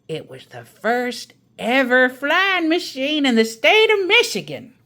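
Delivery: a grandmother topping her sister's story; Grandma's storytelling voice. Acted. Grandma tells her tale.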